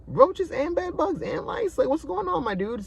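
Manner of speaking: squeaky mock outrage at the absurdity; comic disbelief. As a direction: exclaim it way up high, appalled. Incredulous at the pile of pests.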